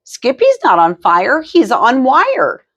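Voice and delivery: put-on high playful child voice, stretching wire; a sister correcting with a sing-song lilt; little sister voice. A narrator's reading. Sister teasing the family in a high sing-song.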